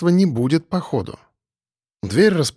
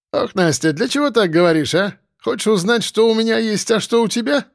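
put-on higher, flirting in a Caucasian accent; light Caucasian accent; 8 semitones higher than usual. A narrator's reading. Roommate flirts with Nastya, light Caucasian accent. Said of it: "довольно сочный акцент".

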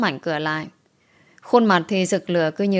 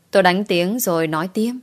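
plain reading voice

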